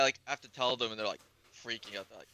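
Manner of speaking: ordinary talk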